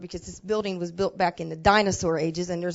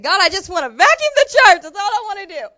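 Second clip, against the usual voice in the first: sobbing plea; 10 semitones higher, 12 dB louder than usual. Retold or imitated. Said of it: "I'm just bawling like a baby".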